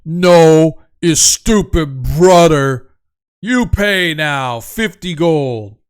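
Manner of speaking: slow, loud giant voice; stubborn giant. Acted. Giant excusing stupid brother, demanding gold, slow and loud. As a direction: insist slowly and loudly, as a dim giant.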